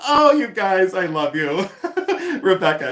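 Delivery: ordinary talk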